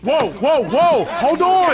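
loud, high whoa, whoa, hold on; alarmed shout. Acted. Stopping armed strangers with a loud high shout. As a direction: stop them with a sharp shout.

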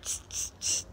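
soft voiceless "tss" repeated; monkey chatter "tss". Acted. Monkeys mimic the peddler, soft tss noises. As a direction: hiss out three short teasing tss sounds. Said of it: "monkeys only shook their fingers back".

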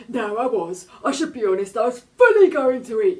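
high, loud, odd cartoon shark voice; a big shark owning up; sheepish shark. A narrator's reading. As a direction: shark admitting it was wrong to the girl.

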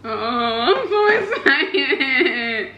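giddy, slow laughing squeal; laughing excitedly. Real, not acted. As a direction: laugh through the words with joy. Excited before first bite.

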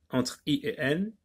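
ordinary talk, nothing marked

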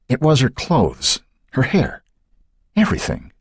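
high, halting, stunned father; soft reply. A narrator's reading. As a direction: answer haltingly, voice lifted high. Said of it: "he replied softly".

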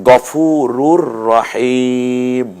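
slow, drawn-out chanted recitation; Quranic recitation. Shown as a demonstration. Teacher recites the verse for the students, slow and chanted. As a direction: recite it melodically, stretching the vowels. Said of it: "এই যে যেমনটা আপনারা দেখছেন".

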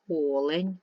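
"Олень" with a long, stressed opening О; teacher's example "Олень", first syllable drawn out. Shown as a demonstration. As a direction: lean on the first vowel of "Олень" and stretch it. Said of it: "у яких наголошений перший склад".

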